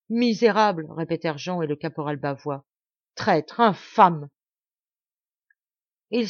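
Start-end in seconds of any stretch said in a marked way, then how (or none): 0.1-0.9 loud, higher cry of contempt; angry insult. A narrator's reading. Companions echoing Maurice against Martial, angry insult. 3.1-4.3 slow, loud, each insult struck hard; angry denunciation. A narrator's reading. Companions denouncing Martial as they flee, angry and emphatic.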